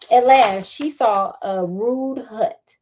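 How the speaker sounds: usual speaking voice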